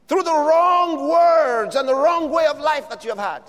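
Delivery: fiery preacher warming up; preaching at full force. Acted. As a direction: lift the congregation with each phrase.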